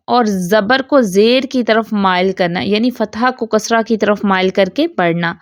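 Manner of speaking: normal talking voice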